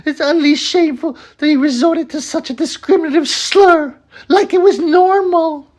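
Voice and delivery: very high, loud falsetto read-out; hammed-up scandalised mum in falsetto; mock her outrage in a shrieky high voice; mock outraged woman. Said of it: "this woman called Adult Human Female".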